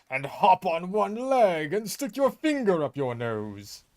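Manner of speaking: slow, loud, pompous spirit voice; booming Avatar Roku. Retold or imitated. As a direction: boom it out like a haughty ghost. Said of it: "he does this bit, where he's like".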